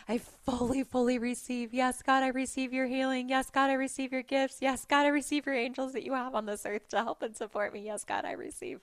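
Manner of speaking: high, insistent chant-like declaration; acted affirmation. Re-enacts her declaration of receiving.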